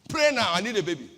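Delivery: loud, insistent demand; urgent plea. Retold or imitated. Mother pleading with the pastor in a restaurant, loud and urgent. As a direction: beg loudly right now.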